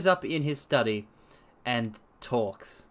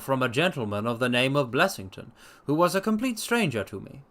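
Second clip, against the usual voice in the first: ordinary delivery, nothing marked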